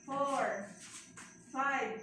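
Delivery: firm, loud counting cadence. Real, not acted. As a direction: count her through the push. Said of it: "coach counting the push". (malakas na pagbibilang)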